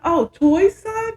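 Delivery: high, slow feigned surprise; mock surprise. [name] fishing for gossip, slow and high. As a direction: draw it out high like gossip bait.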